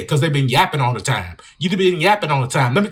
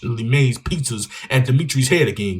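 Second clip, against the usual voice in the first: normal talking voice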